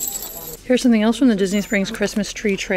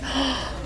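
quick surprised intake of breath; sharp gasp; 5 semitones higher, 8 dB quieter than usual. Real, not acted. Shopper spotting a discount, sharp gasp. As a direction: gasp at a sudden find. Spots a bargain on shelf.

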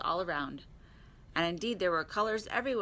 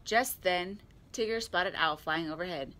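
usual speaking voice

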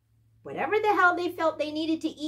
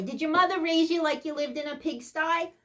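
ordinary talk, nothing marked

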